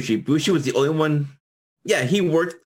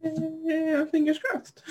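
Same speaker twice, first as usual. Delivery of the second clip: laughing; much slower than usual. Real, not acted. Hopeful joke, laughing.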